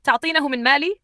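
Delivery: high, indignant question; agitated anger. A narrator's reading. Husband to wife about his money, agitated. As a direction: challenge her, incensed. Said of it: "اقترب منها بعصبية".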